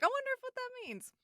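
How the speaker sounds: squeaky high wondering voice; high mock voice. To her cohost, voicing her own confusion in a squeaky high voice. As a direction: pitch up high and puzzled. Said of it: "I was like".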